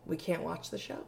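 soft, solemn imitation of a disappointed mother; imitated grave mother. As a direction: mimic mom breaking bad news very softly. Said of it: "looked me in the eyes and said".

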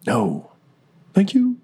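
playful echo that swoops up at the end; mock thanks. Game master trading the thanks back, playful high lilt. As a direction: drop in, then leap very high on "thank you".

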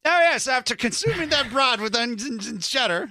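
high, loud overdone British accent; lord-of-the-manor British voice; posh British accent. Shown as a demonstration. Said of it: "Or how about this?".

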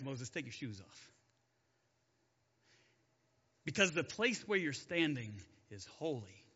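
low, hushed voice of God; deep quiet God voice. Acted. God warning Moses from the bush, low and hushed. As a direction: speak low and still, like a voice from the bush.

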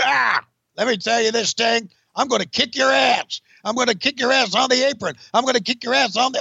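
high hammed-up shouting Sid promo; Sid Vicious. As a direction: bellow it like an unhinged heel wrestler. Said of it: "This is Sid Vicious".

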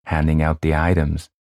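ordinary delivery, nothing marked

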